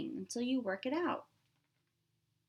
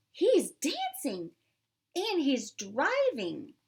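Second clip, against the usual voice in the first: higher, louder, slow wonder; amazed delight; 5 semitones higher, 5 dB louder than usual. Acted. Maxine showing the crowd her dancing fish, amazed. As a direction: marvel at it out loud.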